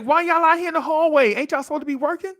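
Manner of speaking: a lightly voiced cocky kid, high and quick at the end; kid calling out teachers. Kid to teachers in the hallway, lightly voiced cheeky kid.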